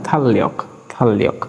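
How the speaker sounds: "থাল লিয়ক" with a long doubled l; double l. Shown as a demonstration. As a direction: hold the l across both syllables of "থাল লিয়ক". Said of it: "double ল উচ্চারণ হয়".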